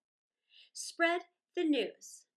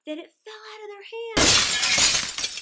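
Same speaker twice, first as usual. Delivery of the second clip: higher, louder and slow as the glass drops; big storyteller climax, raised and drawn out; dramatic climax; 8 semitones higher, 14 dB louder than usual. A narrator's reading. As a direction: cry it out as the glass falls. Glass falls from great height.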